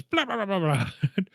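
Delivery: babbled monster jump-scare, starting high; mock monster noise. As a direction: imitate a cheesy monster with a quick burbling babble. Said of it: "a C G I monster goes".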